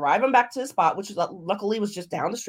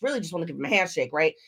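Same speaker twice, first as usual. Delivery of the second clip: ordinary talk, nothing marked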